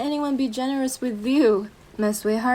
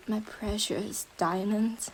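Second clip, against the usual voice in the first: hushed endearment; 2 semitones lower, 10 dB quieter than usual. Acted. Closing possessive endearment.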